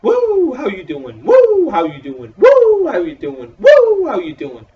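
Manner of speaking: loud, high Ric Flair woo impression; hammed-up wrestler catchphrase, yelled. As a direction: belt out the woo like the Nature Boy. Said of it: "Flair comes out".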